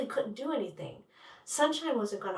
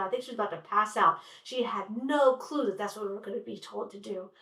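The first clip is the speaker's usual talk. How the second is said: ordinary delivery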